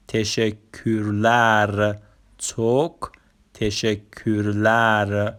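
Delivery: تشکرلر drawn out slowly as teşekkürler, توک as çok; slow Turkish demo. Shown as a demonstration. Teacher models Turkish thanks slowly for learners. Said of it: "اصطلاحاتمون رو به ترتیب بخونیم".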